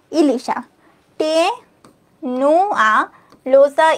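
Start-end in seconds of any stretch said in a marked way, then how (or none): 1.2-3.9 very slow demo. Shown as a demonstration. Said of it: "ইলিশ হচ্ছে টেনুয়া লোসা".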